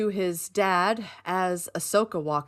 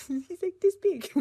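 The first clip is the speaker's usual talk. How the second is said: laughing; 12 semitones higher, 4 dB louder than usual. Real, not acted. Laughing at tiny hologram.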